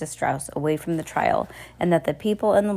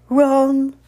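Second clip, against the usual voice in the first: loud, high, held clock toll; booming clock strike; 8 semitones higher, 7 dB louder than usual. A narrator's reading. Enormous hotel clock strikes, loud drawn-out toll.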